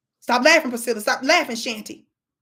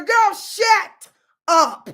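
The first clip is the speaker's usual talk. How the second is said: exasperated retort; 3 semitones higher, 4 dB louder, much slower than usual. Pretend. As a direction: stretch it out in mock exasperation. Exasperated retort to played clip.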